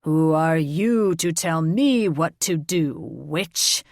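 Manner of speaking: a touch of a dragon's snarl. A narrator's reading. Dragon king snarling at the witch who defies him. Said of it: "Tormund arched back and snarled".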